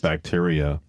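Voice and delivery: ordinary talk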